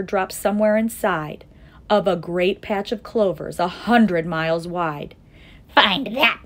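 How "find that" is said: loud, taunting bird; sneering. A narrator's reading. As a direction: throw it out as a sneering dare. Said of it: "sneered the bird".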